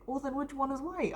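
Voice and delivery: high-pitched mimicked questioning; imitated puzzled teacher. Teacher questioning a pupil about the parents, high imitated puzzled voice. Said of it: "And she's like".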